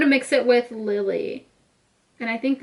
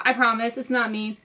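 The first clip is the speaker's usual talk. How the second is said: ordinary talk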